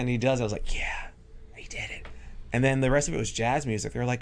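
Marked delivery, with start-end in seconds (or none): 0.7-2.1 whisper. Retold or imitated. Quiet glee after hypnosis works.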